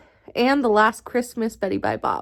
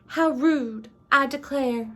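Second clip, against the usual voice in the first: higher-pitched, put-on Southern belle; Southern accent; 4 semitones higher than usual. Narrator voices Blanche lamenting rudeness, put-on Southern accent. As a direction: act affronted in a Southern accent. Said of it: "That was my best Southern accent".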